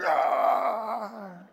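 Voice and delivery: long drawn-out mock growl; wordless cry of the caveman let loose; caveman roar. Caveman taking over the wheel.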